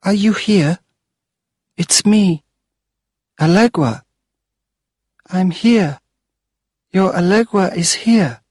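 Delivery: put-on high, hopeful child's voice; a small girl seeking her mother at the grave; calling little ghost.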